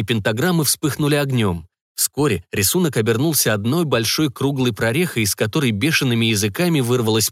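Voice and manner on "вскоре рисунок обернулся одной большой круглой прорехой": usual speaking voice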